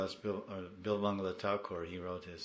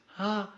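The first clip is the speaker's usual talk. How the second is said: put-on scared child sound; 13 semitones higher, 9 dB louder than usual. Scared child Krishna in mother's bed.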